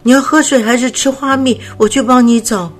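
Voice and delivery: the cub anxiously offering help to his sick friend; flustered worry. Acted. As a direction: rush the offer out in a fright. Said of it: "球球连忙问他".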